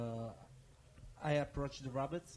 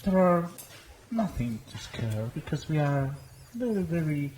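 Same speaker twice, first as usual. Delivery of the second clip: put-on hams up a friendly greeting to the frightened children; eager adventurer in character; 2 semitones higher, 10 dB louder than usual. Player speaks in character.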